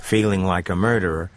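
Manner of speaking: ordinary talk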